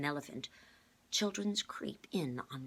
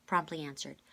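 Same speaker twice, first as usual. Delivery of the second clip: ordinary delivery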